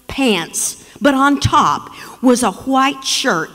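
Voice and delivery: normal talking voice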